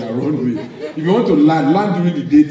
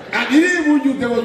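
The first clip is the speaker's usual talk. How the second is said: normal talking voice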